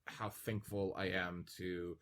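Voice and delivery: usual speaking voice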